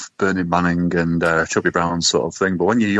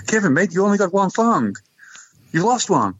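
higher-pitched, lightly acted mate; joke dialogue; 10 semitones higher than usual. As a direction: put the question to your mate in a raised voice. Acting out a joke's dialogue.

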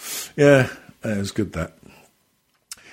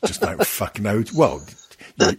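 ordinary delivery

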